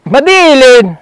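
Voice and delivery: loud call across the yard. Real, not acted. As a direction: call her name from far away. Calling a student from afar.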